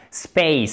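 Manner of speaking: "Space" with a gliding AY sound; full diphthong. Shown as a demonstration. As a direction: glide the vowel in "Space" from E towards I. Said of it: "c'est une diphtongue". (« Space » avec une diphtongue bien marquée)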